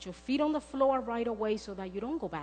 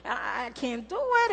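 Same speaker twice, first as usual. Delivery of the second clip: nervous panic; 6 semitones higher, 5 dB louder than usual. Retold or imitated. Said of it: "I start shaking".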